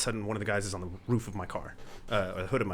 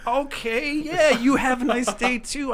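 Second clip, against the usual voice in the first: bemused farewell; 7 dB louder, slower than usual. Retold or imitated. Driver stunned farewell.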